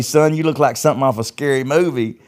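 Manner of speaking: a worried mother fussing at her son; hammed-up mom scolding her son. Voicing mom's scolding.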